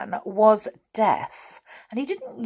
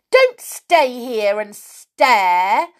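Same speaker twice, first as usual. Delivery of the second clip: slow, loud, cross shout; 4 semitones higher, 6 dB louder, much slower than usual. A narrator's reading. Connie shouting at the staring saucepan man, loud and slow. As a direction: raise the voice and stretch it angrily. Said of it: "shouted Connie".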